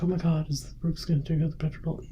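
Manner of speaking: high-pitched, lightly hammed-up alarm; mock panicked viewer. Imitating worried viewers asking about the dollar, mock panic.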